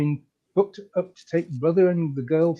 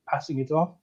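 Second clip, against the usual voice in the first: plain reading voice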